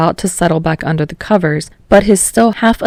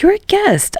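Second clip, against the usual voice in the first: flustered. Acted. Flustered protest.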